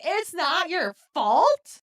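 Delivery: high, slow, drawn-out disbelief; incredulous echo. Retold or imitated. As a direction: throw it back as a stunned question. Repeats the line in disbelief.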